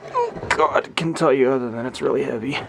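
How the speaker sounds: high, loud strained voice; straining. Real, not acted. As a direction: grunt it out while lifting. Lifting heavy horn again.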